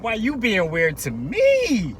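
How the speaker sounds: high, loud, slow whine; mock whining. Buyer whining at the dealer's door. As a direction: stretch it out like a hurt buyer.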